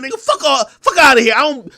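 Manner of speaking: normal talking voice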